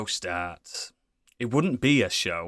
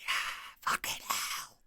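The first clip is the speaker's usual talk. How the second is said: whispered curse; 14 dB quieter than usual. Real, not acted. Exasperated whispered aside.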